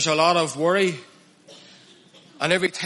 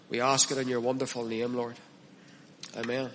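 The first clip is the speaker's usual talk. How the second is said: soft, hushed pastor's prayer; 4 semitones lower, 5 dB quieter than usual. Acted. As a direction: pray quietly and humbly to the Lord. Opening prayer before the sermon.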